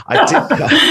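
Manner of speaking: breaking into a high giggle mid-word; laughing. Real, not acted. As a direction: laugh through it, losing it a little. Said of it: "sounds like I've had a drink today".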